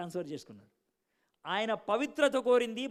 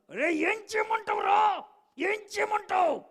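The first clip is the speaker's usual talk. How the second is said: desperate shout; 8 dB louder, slower than usual. Retold or imitated. Retold outburst after fuses blew.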